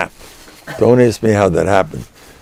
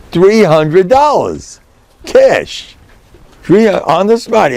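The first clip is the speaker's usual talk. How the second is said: excited; 9 semitones higher, 3 dB louder, slower than usual. Real, not acted. Hyping the cash.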